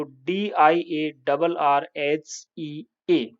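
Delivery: letter by letter, deliberate; American spelling, spelled slowly. Shown as a demonstration. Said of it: "यहाँ ओई के स्थान पर केवल ई लिख दोगे".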